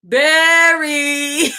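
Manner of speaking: high loud drawn-out call. Real, not acted. Reader hollers at a friend while laughing. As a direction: holler his name across the room. Calling out to someone.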